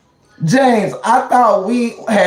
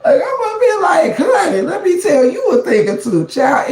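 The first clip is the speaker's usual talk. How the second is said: laughing; 9 semitones higher than usual. Real, not acted. Sassy mock quote while laughing.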